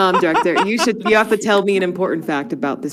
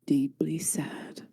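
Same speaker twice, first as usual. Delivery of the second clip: solemn probing; slower than usual. Acted. Seer asking a stranger about her hidden sorrow, solemn and slow. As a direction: let it out slowly, fading to near-whisper.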